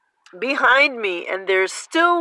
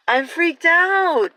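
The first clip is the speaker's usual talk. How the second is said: slow, louder, high-pitched fright; freaked out; 7 semitones higher, 6 dB louder, slower than usual. Real, not acted. Alone with the birds, slowly cries out being freaked out. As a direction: let the fear spill out, drawn out. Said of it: "I am freaked out right now".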